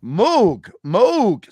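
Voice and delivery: Moog as a drawn "mohg", between O and U; demonstrated pronunciation. Shown as a demonstration. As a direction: say Moog twice, each with a swooping long O. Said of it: "So it's half O, half U".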